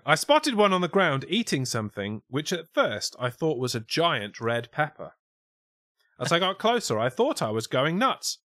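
lifted, projected mock-Scouse reading; put-on Scouse witness. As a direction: read the witness statement in hammed-up Scouse. Reads witness quote in put-on accent.

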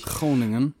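Groningen with a scraped Dutch G; Dutch pronunciation. Shown as a demonstration. Co-host trying out the Dutch Groningen, Dutch pronunciation. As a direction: echo Groningen with a throaty first sound.

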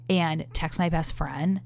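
usual speaking voice

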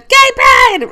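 loud high cheer; shouted. Retold or imitated. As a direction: belt it out at the top of your lungs. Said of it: "I shouted".

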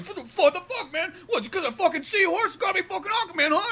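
hammed-up Aquaman squealing in outrage at his teammates; squeaky defensive Aquaman. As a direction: crank Aquaman's pitch way up like he's been wronged. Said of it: "him just being so defensive".

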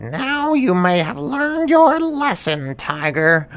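high, slow, lecturing monkey; monkey teaching the tiger; smug monkey. Acted. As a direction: drawl the lesson high and pleased. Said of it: "And the monkey said".

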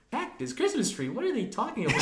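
high, puzzled outsider; mock the confused audience; confused viewer. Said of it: "Everyone else is like".

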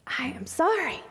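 a soft, unvoiced, regretful whisper; whispered refusal. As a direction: whisper the apology. Lawyer refusing client again.

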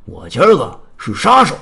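loud, emphatic announcement; menacing declaration. Acted. Heida raising his gun at the champion, loud menacing declaration. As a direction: declare it forcefully, as a threat.

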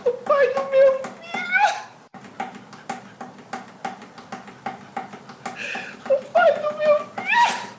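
high wailing cry; anguished cry. Acted. Crying out in anguish.

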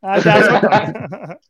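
slow, laughing protest. Real, not acted. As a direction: laugh it off, embarrassed. Laughing at being teased.

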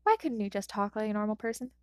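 usual speaking voice

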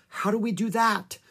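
high-pitched, mildly distressed question; worried cry. A narrator's reading. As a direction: ask it high and anxious. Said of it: "cried Annie".

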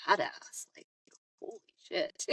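hushed, awed whisper. Real, not acted. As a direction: breathe it out in awe. Awed aside about a mental image.

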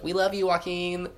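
high, drawn-out fawning; mock adoring fans. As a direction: gush like an adoring crowd, slow and high. Fans' adoring reply, acted.

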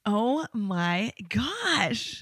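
very drawn-out, slow "oh my gosh"; stunned reaction. Real, not acted. Friend hearing about the police escort home, slowly astonished. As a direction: stretch every word in disbelief. Listener reacts to the wild story.

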